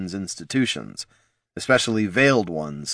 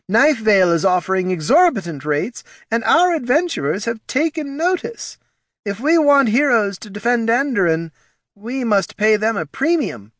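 nervous advisor voice; 14 semitones higher, 3 dB louder than usual. Acted. Reads Ortson's dialogue.

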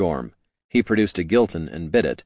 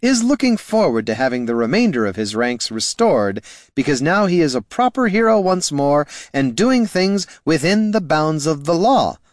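raised voice; 8 semitones higher, 4 dB louder than usual. Acted. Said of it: "the ranger shouted".

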